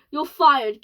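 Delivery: angry firing. Acted. Boss firing astronaut.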